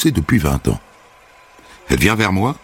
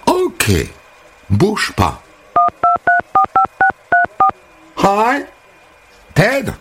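high, gushing hairdresser greeting; sing-song high welcome; bubbly American hairdresser; 11 semitones higher than usual. Acted. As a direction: chirp it like an overfriendly American. Holly phones Ted.

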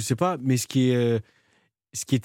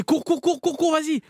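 urging shout; 14 semitones higher than usual. Retold or imitated. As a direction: push him to run, shouting. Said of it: "il me disait".

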